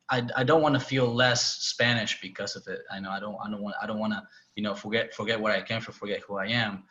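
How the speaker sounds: ordinary talk, nothing marked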